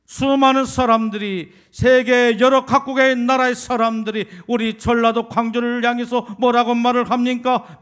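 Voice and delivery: loud, rising sermon climax; fiery preaching. Acted. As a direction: preach it out full force to the congregation. Preacher rises to full force.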